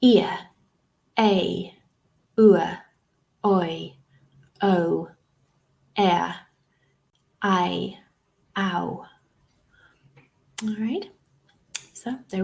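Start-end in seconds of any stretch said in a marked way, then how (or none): 0.0-9.0 isolated vowel glides, a pause after each; diphthong demonstration. Shown as a demonstration. Teacher repeating the diphthongs for students, isolated sounds. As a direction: model each two-sound vowel on its own. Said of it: "so let's repeat".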